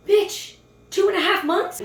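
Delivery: shouted high and loud, incredulous; outraged disbelief. Real, not acted. Ranting to viewers about a man's excuse, shouted high disbelief. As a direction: yell it in total disbelief, pitch shooting up.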